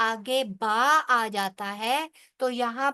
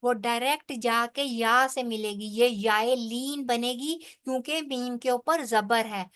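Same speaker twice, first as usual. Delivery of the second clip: ordinary talk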